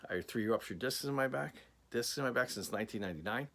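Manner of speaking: normal talking voice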